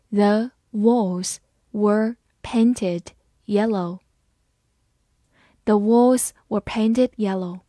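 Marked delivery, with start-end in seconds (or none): 0.1-4.0 very slow, one word at a time; slow read-aloud. Shown as a demonstration. Teacher modelling the painted-walls sentence, slowly. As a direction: read it slowly with a gap after each word. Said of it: "好，慢慢来读".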